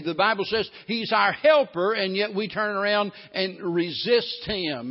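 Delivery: a revival preacher hammering each line; full-force preaching. Acted. Preaching repeated refrain.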